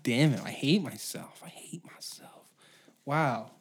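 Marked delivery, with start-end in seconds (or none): 1.4-2.5 whispered self-loathing. Real, not acted. Host whispering self-disgust after stuttering. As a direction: mutter it under your breath in disgust.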